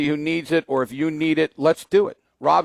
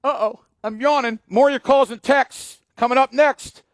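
big booming radio tease; radio announcer; 10 semitones higher, 4 dB louder than usual. Acted. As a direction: sell the next segment.

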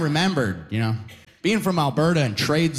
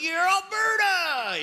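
high, drawn-out mock pride; proud Albertan; 14 semitones higher, much slower than usual. Albertan boasting about home, high slow mock pride. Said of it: "you're like".

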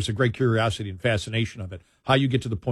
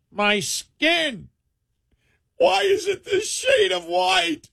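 high, drawn-out mock lament; mock anguished wailing; 11 semitones higher, slower than usual. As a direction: wail in exaggerated self-loathing, pitched way up.

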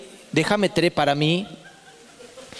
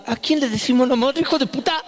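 indignant mother; 7 semitones higher than usual. Retold or imitated. Mother retorts at son.

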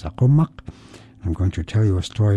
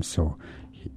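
plain reading voice

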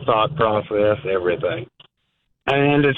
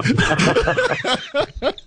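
a sudden high laugh; high burst of laughter. Real, not acted. Laughing at the host's story of the bench.

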